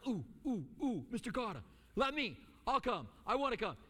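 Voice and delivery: put-on like a kid waving a hand to be chosen; eager volunteer. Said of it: "And you are, are like".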